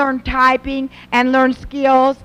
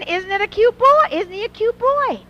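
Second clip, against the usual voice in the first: lightly voiced teen girl, pitched higher; imitating daughter; 10 semitones higher than usual. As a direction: mimic the starry-eyed daughter gushing. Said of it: "She was just saying".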